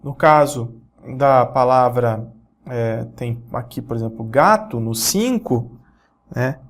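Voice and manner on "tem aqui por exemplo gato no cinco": usual speaking voice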